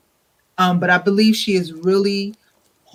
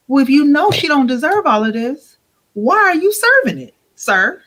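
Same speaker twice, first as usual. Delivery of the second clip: higher, louder rhetorical jab; indignant challenge; 7 semitones higher, 3 dB louder than usual. Real, not acted. Guest confronting the husband's hypocrisy, indignant. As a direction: call him out sharply.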